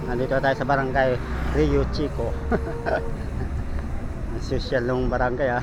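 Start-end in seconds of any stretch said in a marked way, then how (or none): 2.4-4.3 breathy laugh. Real, not acted. Laughing at barangay name.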